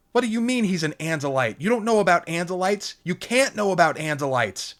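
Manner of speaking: high, loud alarmed protest; alarmed girl. A narrator's reading. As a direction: panic rising in disbelief. Lauren panics, voice rising.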